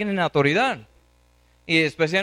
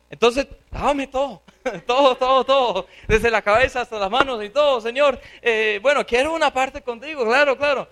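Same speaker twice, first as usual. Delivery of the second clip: high-pitched, gushing eagerness; eager reversal; 5 semitones higher, 3 dB louder than usual. Acted. Peter now wants all of himself washed, eager reversal. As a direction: beg for all of it, high and excited.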